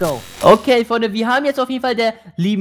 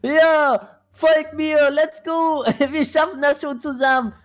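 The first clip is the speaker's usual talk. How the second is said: excited cheer; 6 semitones higher, slower than usual. Real, not acted. Cheering on the army.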